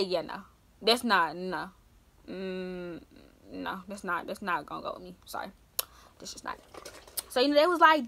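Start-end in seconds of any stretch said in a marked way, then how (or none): 0.0-6.6 quiet refusal. Retold or imitated. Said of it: "I say".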